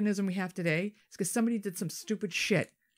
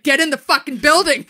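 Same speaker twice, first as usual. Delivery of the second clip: yelled, much higher and louder; angry shout; 9 semitones higher, 16 dB louder than usual. Retold or imitated. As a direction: bark the order at full volume. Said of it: "He's like".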